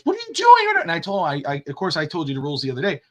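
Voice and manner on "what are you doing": put-on high, loud whiny kid voice; a child's high indignant squeal. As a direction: mimic a son's angry whine. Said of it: "he goes".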